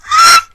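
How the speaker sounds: shrill, very high, loud shriek; high scream. Acted. Mary screaming as Groven storms, shrill shriek. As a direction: shriek in alarm.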